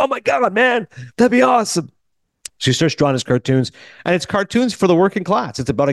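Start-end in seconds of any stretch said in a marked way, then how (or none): 0.0-1.9 a rough, raspy imitation pitched much higher; raspy Pekar voice. Podcaster imitating Harvey Pekar's rasp for his listeners, raspy and high. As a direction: scrape the words out like a grumpy comic writer. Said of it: "kinda like raspy".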